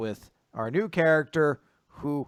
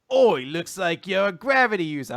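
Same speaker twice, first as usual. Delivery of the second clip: hammed-up British accent, higher and louder; 4 semitones higher, 5 dB louder than usual. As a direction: put on a mock British voice. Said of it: "I don't know why he's British now".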